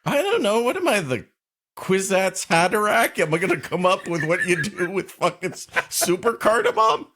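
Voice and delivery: rant, fed up with the question; exasperated rant. Real, not acted.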